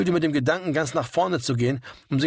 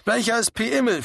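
higher-pitched, calling over noise; raised-voice question; 7 semitones higher than usual. A narrator's reading. Shouting over loud band music.

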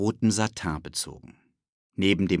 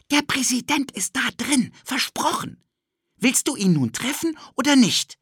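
put-on high, eager little-boy voice; a pushy kid tugging at his friend; cheeky boy Ted; 4 dB louder than usual. Ted pulls Philipp along, eager high boy voice.

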